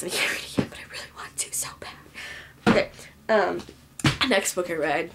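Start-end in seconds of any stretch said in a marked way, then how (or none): 0.0-1.9 hushed, voiceless whisper; whispered longing. Real, not acted. Book vlogger longing for the sequel she can't read yet, whispered. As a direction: trail into a soft, yearning whisper.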